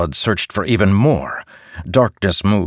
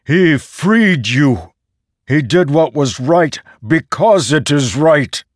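loud, higher, slow insistent plea; raised voice; 7 semitones higher, 4 dB louder, slower than usual. Acted. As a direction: defend him forcefully. Character pleads, defending Renarin.

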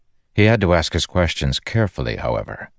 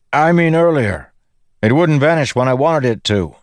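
high, raised voice; shouting; 10 semitones higher, 4 dB louder than usual. A narrator's reading. Dalinar covering his mistake to the Windrunner, shouting. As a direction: call the excuse out over the wind. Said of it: "Dalinar shouted".

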